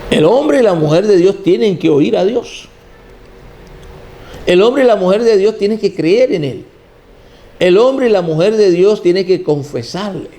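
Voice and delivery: preaching. Acted. Predicación en crescendo.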